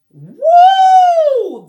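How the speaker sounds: very high, very loud angry owl hoot. Acted. Angry owl hooting at Douglas, loud high hoot.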